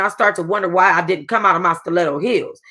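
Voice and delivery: ordinary delivery, nothing marked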